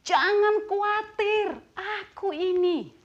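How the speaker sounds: high, slow, emphatic reassurance; Jesus. Acted. Jesus telling the disciples not to worry, emphatic reassurance. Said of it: "Yesus berkata kepada mereka".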